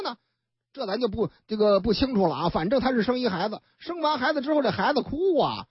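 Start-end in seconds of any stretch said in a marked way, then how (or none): none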